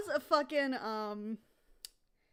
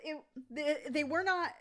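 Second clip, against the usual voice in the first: usual speaking voice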